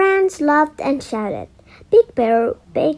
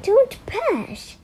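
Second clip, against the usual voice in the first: high, far-off teacher voice; a firm teacher's warning; Mister Wise voice; 11 semitones higher than usual. A narrator's reading. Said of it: "said Mister Wise".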